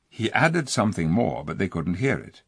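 plain reading voice

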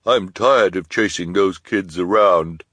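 slow, loud, sneering villainess. Acted. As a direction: drawl it with contempt. Esme's villain voice continues.

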